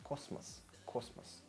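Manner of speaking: "cosmos" with a sharp S in the middle, almost whispered; S not Z. Shown as a demonstration. Teacher correcting the Z sound, hushed demo. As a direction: hiss the S in "cosmos", barely voiced. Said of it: "we just say S".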